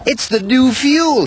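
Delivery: very high, alarmed cry; upset cry. A narrator's reading. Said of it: "he cried".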